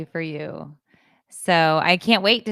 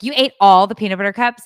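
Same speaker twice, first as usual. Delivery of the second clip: loud, quick, raised-pitch accusation; imitated accusation; 4 semitones higher, 10 dB louder, faster than usual. Daughter confronting mother over eaten candy, loud accusation. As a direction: blurt it out as an outraged accuser.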